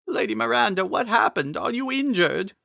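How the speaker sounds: put-on an old protector fussing over her lady; worried Impa. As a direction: Impa asking the fallen Miranda if she's hurt, worried. Impa voiced in story.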